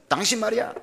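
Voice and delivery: loud, high retold shout at his wife; a husband venting his frustration, shouting; yelling at wife. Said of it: "막 소리를 질렀습니다".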